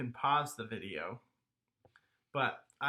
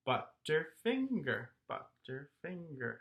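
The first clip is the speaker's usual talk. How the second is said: "Butterfinger" slowly in four beats; syllable by syllable; 5 dB quieter than usual. Shown as a demonstration. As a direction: count out each syllable of "Butterfinger". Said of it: "How many is that?".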